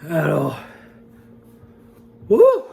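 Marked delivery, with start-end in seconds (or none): 2.3-2.6 loud high woo from the heat; whoop. Real, not acted. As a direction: shout out the burning pain. Burning mouth after hot pepper.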